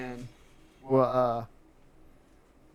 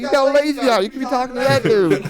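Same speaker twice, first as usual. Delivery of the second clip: loud teasing; 10 semitones higher, 8 dB louder than usual. Retold or imitated. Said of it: "He's like".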